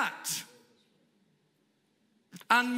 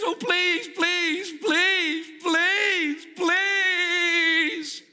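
high, pleading chant of please; whiny begging; 10 semitones higher, much slower than usual. Acted. Ben begs the manager for free coffee, high and whiny. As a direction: beg like a child for a treat.

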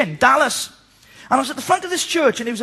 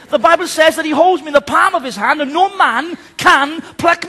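loud, high, rising preacher's declaration; preaching at full force; 3 semitones higher, 5 dB louder than usual. Acted. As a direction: proclaim each promise louder than the last. Builds to a crescendo of scripture.

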